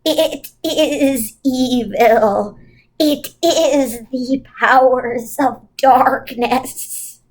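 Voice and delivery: very slow, ominous pronouncement; ominous witch voice. Acted. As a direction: intone it like a doom.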